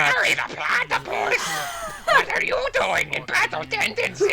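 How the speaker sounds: high, shouted cartoon villain voice; Doctor Doofenshmirtz voice. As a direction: yell it like a frantic cartoon scientist. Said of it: "If you wanna hear my Doctor Doofenshmirtz voice".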